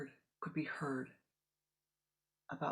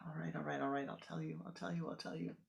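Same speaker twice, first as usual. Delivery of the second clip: softer, giving in, repeating it; breaking down; 5 dB quieter, faster than usual. Acted. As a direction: cave in under the pressure. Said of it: "Leila breaks down".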